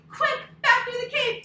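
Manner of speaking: high, loud hurried call; urgent shout. Acted. Story teacher to kids fleeing the bear, urgent. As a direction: urge everyone to flee fast.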